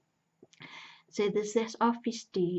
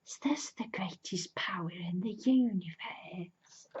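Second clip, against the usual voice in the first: hushed, awed whisper; wistful whisper. Retold or imitated. Loki holding the green stone, whispered awe. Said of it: "he's sort of wistful".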